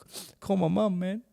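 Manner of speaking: high, tearful plea to his brother; tearful pleading. Retold or imitated. Crying before a title fight.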